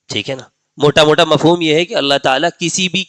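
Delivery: ordinary talk, nothing marked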